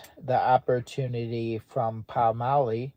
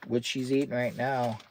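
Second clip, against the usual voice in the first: normal talking voice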